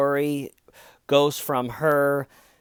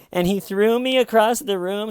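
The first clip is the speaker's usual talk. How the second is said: high-pitched imitation of faked distress; badly faked upset, pitched way up; mock distraught; 8 semitones higher, 4 dB louder than usual. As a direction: put on a distraught caller voice. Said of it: "in the distraught tone of voice".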